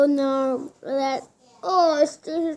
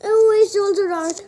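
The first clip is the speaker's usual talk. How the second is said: alarmed; 6 semitones higher, 6 dB louder than usual. Real, not acted. Dread at another dark chocolate.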